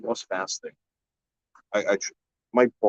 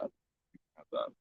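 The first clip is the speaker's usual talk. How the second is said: much softer, slower, unvoiced repeat; whispered aside; 14 dB quieter, slower than usual. Shown as a demonstration. Repeating to Grizz that he can keep the bear meat, as a whispered aside. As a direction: breathe the line away as a private aside. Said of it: "Chris, you can have that".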